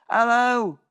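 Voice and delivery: squeaky-high imitation of a greeting; high-pitched greeting. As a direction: send up your own radio hello. Acts out his radio greeting.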